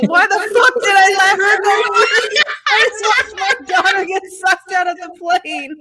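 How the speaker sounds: high-pitched retold outburst breaking into laughter; laughing mock horror. Daughter retelling dad's skydive regret, laughing mock horror. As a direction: imitate a horrified dad while cracking up.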